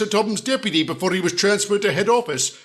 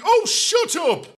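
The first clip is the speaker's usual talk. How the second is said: exasperated outburst; 12 semitones higher, slower than usual. Acted. Exasperated at a taunt.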